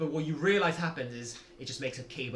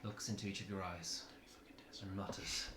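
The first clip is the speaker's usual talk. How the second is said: dramatic narration; 6 semitones lower, 12 dB quieter than usual. A narrator's reading.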